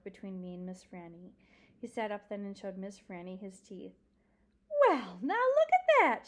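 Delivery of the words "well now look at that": high, loud, swooping amazement; delighted surprise. Acted. Old librarian seeing the dog show his teeth, delightedly surprised. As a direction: exclaim with great delighted wonder.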